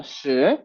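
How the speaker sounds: a low "shi" rising upward. Shown as a demonstration. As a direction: start "shi" low and lift it. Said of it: "De abajo hacia arriba".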